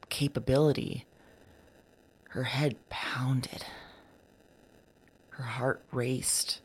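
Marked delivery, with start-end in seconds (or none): 2.3-6.6 slow, breathy whispered narration. Real, not acted. Recorder describing the woman's overwhelmed body, slow whisper. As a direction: whisper each beat slowly.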